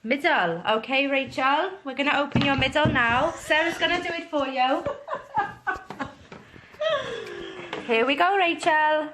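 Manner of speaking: put-on Welsh lilt; Welsh accent. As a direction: speak in an amateur Welsh accent. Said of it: "impressed with your Welsh accent".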